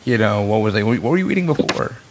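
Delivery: usual speaking voice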